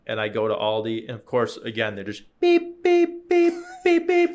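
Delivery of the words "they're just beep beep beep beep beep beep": rapid high squeaky beeps; scanner beeps. Retold or imitated. Telling friend how fast Aldi scans, squeaky high beeping.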